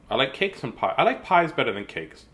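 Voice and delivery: usual speaking voice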